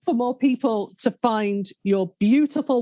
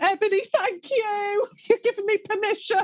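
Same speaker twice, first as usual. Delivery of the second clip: tearful laughing; 10 semitones higher than usual. Real, not acted. Moved to tears by friend's kindness.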